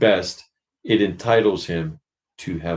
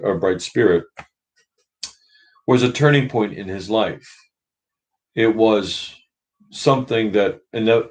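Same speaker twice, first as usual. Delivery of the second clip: ordinary talk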